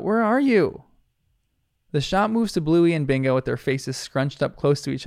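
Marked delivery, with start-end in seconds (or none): 0.0-0.8 higher, calling a name across the yard; yelling out. Acted. Judo searches the backyard for Bluey, yelling. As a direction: yell out for her friend. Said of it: "she yells out".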